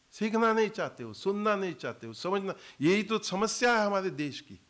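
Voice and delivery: scolding. Real, not acted. Guru scolds listeners who won't learn.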